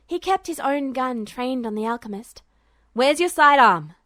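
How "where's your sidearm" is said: loud call across the room. Acted. Duo calling across to Hild, loud. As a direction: shout the question over.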